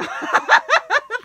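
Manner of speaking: high, loud emotional laugh; teary laugh. Real, not acted. Laughing at her own tears over the gift. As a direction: laugh through rising tears. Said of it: "I'm about to cry now".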